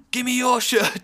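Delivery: loud, high demanding yell; imitating an insistent fan's shout; retold shout. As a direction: holler it at the stage from the crowd. Fan yelling demand at the band.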